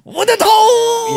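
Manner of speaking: loud, high, drawn-out mock Japanese shout; exaggerated Japanese-style holler; mock Japanese accent. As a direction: shout it long like hammed-up Japanese. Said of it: "make some sounds and see if it was something in Japanese".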